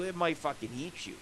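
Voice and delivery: usual speaking voice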